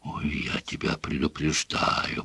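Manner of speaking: hushed warning; threatening whisper. A narrator's reading. Father warns his son in a whisper. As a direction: whisper it as a menace.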